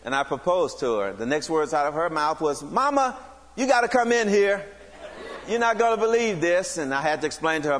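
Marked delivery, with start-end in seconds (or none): 2.6-6.8 calling out to mama. Retold or imitated. She calls her mother in.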